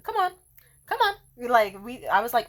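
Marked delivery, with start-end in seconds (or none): 0.0-1.1 high-pitched teasing nudge; playful coaxing. Retold or imitated. Woman coaxing her lover into a game, playful coaxing. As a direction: egg him on in play.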